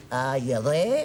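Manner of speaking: imitated Kiwi phone greeting; mock Kiwi accent, questioning lilt. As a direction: mimic a New Zealander answering. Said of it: "why I knew he was a Kiwi".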